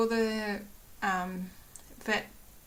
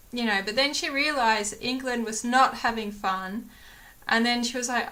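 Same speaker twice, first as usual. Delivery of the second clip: ordinary delivery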